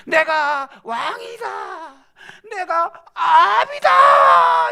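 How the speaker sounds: high, slow, crying shout; desperate wounded king. Acted. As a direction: wail for rescue in panic.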